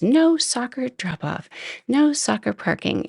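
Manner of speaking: high-pitched, emphatic scolding; mock yelling. Winery woman quoting her sign at drivers, mock yelling. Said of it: "she just kept yelling and yelling".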